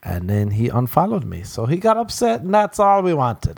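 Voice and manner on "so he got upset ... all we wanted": high, gleeful triumph; high gloating laugh in the voice. Real, not acted. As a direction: crow over the win, pitched way up. Gloating after troll unfollows.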